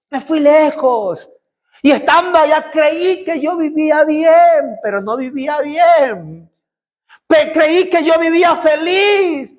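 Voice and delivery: high, slow pleading confession; a son begging his father, voice lifted and drawn out; pleading repentant son. Acted. Preacher voicing the prodigal son to his father, high slow lament.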